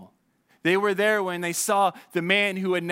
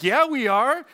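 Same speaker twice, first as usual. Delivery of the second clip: higher, louder mock exasperation; a sharp sarcastic "yeah, we are"; sarcastic retort; 7 semitones higher, 5 dB louder, slower than usual. Nervous passenger answering the pilot, sarcastic and loud.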